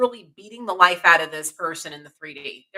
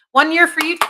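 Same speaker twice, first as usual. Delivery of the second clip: excited; 8 semitones higher, 9 dB louder than usual. Real, not acted. Viewer shares one year sober.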